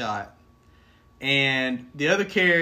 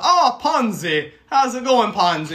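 slow, much higher and louder silly voice; made-up character voice; 7 semitones higher, 8 dB louder, slower than usual. Retold or imitated. Player imitating his Goliath greeting a tiny companion, silly voice. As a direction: ham up the goofy Goliath greeting. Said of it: "making this voice up".

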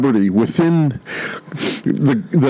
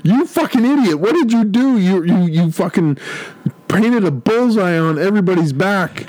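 higher, hammed-up chewing-out; mock furious officer; 7 semitones higher than usual. A Cobra officer rips into the rampaging henchman, high mock rage. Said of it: "Cobra's going".

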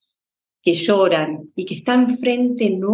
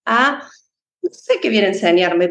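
usual speaking voice

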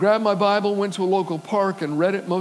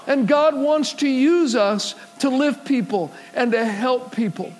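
loud, higher, slowing preacher's climax; preaching at full force; 5 semitones higher, 4 dB louder than usual. Acted. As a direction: drive the truth home to the congregation.

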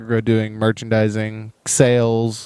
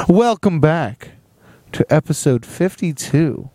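show intro; 3 semitones higher than usual. Acted. Podcast intro greeting.

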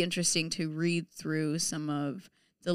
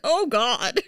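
loud, higher groan of dread; hammed-up dread at the workload; mock dismay; 5 semitones higher, 9 dB louder than usual. Applicant reacting to a long page requirement, mock dismay.

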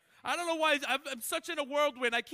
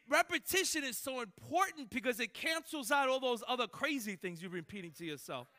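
ordinary talk, nothing marked

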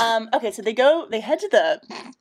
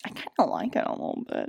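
quick, low near-whisper; hushed admission; 6 dB quieter, faster than usual. Retold or imitated. Toph admitting she enjoyed the spa, hushed. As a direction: mutter it quickly under the breath, softly. Toph's shy admission, retold.

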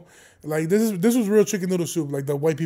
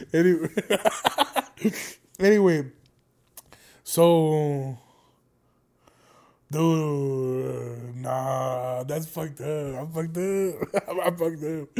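chagrined self-reproach; much slower than usual. Real, not acted. Host owning up to a sick joke, slow and chagrined.

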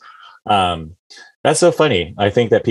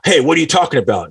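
higher-pitched challenge in an acted quote; mock indignation; 6 semitones higher than usual. Imitating a touchy person objecting to a word, mock indignant. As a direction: act out someone being a jerk about it. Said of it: "instead of".

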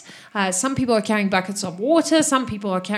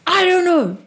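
upset; 9 semitones higher, 6 dB louder than usual. Acted. NPC answers, upset.

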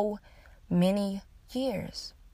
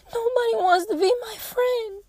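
put-on high, teary child's wail; imitating a crying child; 13 semitones higher than usual. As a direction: mimic a little girl sobbing. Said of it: "She immediately started crying".

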